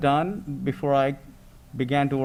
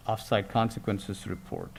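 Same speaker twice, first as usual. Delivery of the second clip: ordinary talk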